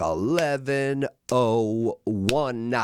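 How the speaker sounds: slow, stretched dramatic count; slowly spelled-out time check; drawn-out reveal. Retold or imitated. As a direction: drag out each word for suspense.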